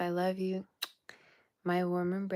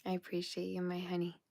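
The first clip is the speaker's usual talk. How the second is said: ordinary delivery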